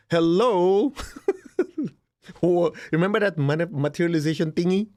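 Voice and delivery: playful wake-up call; sarcastic hello. Real, not acted. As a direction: call out to someone not thinking. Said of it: "sarcastic wake-up call".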